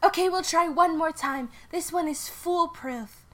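high, assured Sally voice. Acted. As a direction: promise it cheerfully.

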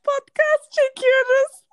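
calling out slowly to someone away. Real, not acted. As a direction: call loudly across the house. Calling out to mother.

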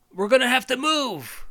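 high, loud heroic call; rally the others; Buzz. Acted. Buzz telling the toys they must move, high and loud. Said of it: "Buzz is like".

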